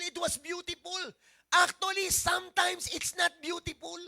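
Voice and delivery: loud, rising preacher's drive; full-force preaching. Acted. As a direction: pastor pushing the point to the congregation, preaching hard.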